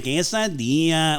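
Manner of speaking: "quesadilla" with hammed-up Spanish vowels; overdone Spanish accent. Shown as a demonstration. Friend showing what he avoids, exaggerated Spanish accent. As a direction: put stank on it, mock Spanish accent. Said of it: "I'm not fucking putting a stank on".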